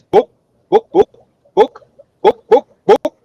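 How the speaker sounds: high, loud clucking chicken, book book; hammed-up chicken squawk. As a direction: cluck each book like a hen. Chicken asks librarian for book.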